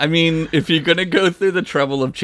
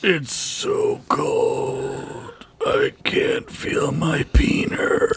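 freezing character voice; much slower than usual. Pretend. Sudden bit, freezing character voice.

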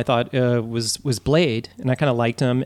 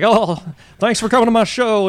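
higher, louder hammed-up [name] thank-you; Vegas [name]; 7 semitones higher, 4 dB louder than usual. Friend imagining a Vegas show, hammed-up [name] greeting. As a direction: croon it like [name] on a Vegas stage. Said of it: "He just like".

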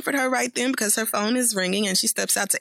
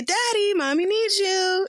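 sweet call; 9 semitones higher than usual. Retold or imitated. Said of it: "we hear Tiffany say".

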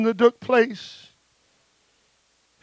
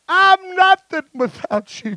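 anguished cry; 10 semitones higher, 6 dB louder, slower than usual. Acted. Peter's imagined broken prayer.

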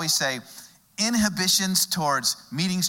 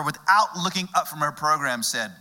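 ordinary talk

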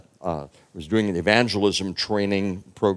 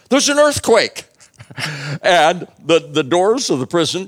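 much higher, louder punchline; excited reveal; 5 semitones higher, 5 dB louder than usual. A narrator's reading. Preacher telling his congregation about the earthquake, excited. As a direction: land the earthquake with delight. Climax of the story.

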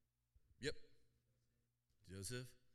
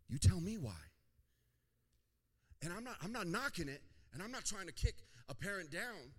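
higher, louder, insistent pulpit voice; fervent preaching; 7 semitones higher, 6 dB louder than usual. Real, not acted. Pastor cautioning youth about sharing dreams, fervent preaching. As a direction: press the point home to the whole room.

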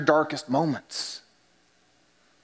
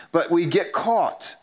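ordinary talk, nothing marked

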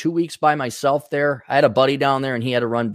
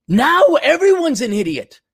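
ordinary talk, nothing marked

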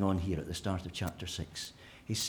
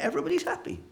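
mock glee; faster than usual. Mock conclusion.

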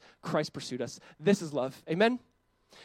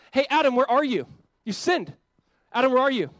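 loud, higher call across a garden; 7 semitones higher, 8 dB louder than usual. Retold or imitated. God searches for Adam after he sinned, calling out. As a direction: call out for someone lost.

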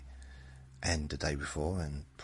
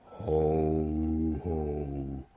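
long, drawn-out low ho-ho; slightly lowered Santa boom; deep Santa voice; 2 semitones lower than usual. Shown as a demonstration. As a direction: hold each "ho" in an attempted deep voice. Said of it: "That's about as deep as I can get".

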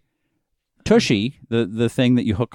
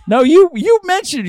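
much higher, louder objection; indignant protest; 6 dB louder than usual. Real, not acted. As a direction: jump in to protest. High protest.

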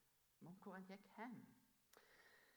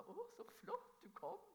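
put-on high, delighted old mother's voice; imitating elderly mother; 10 semitones higher, 4 dB louder than usual. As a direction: lift it up like a frail, happy mum. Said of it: "smilte hun sånn opp og sa".

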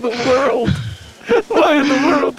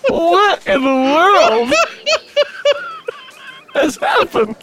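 slow disbelief; 10 semitones higher, much slower than usual. Real, not acted. Disgusted reaction to chewing noise.

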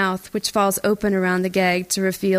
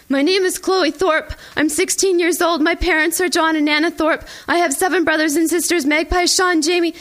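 much higher, rushed, terrified recital of her name; frightened recital; 9 semitones higher, 3 dB louder than usual. Acted. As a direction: gabble out the ID protocol in panic, pitched high. Reciting protocol at gunpoint.

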